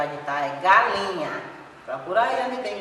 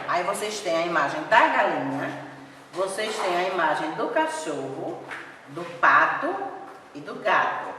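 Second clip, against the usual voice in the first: normal talking voice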